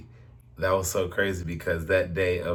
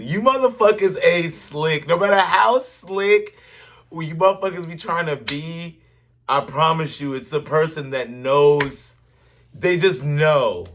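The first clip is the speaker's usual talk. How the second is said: higher, louder preaching rant; fired-up rant; 7 semitones higher, 4 dB louder than usual. Real, not acted. Cohost ranting about cheaters to the listeners. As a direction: call them out, all worked up.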